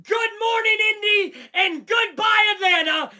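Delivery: high, shouted farewell lines; shouted quote. Retold or imitated. Fan voicing the star runner's farewell to the cities, high and shouting. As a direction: yell each goodbye like a victory cry. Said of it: "Jonathan Taylor says".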